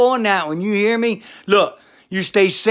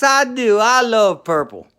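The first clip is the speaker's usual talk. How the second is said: ordinary talk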